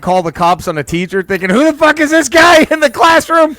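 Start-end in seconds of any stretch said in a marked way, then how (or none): 1.5-3.6 imitating an indignant outburst, high and loud; alarmed disbelief. Voicing the kid calling cops on a teacher, alarmed. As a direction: act out loud, high-pitched bewilderment with a laugh.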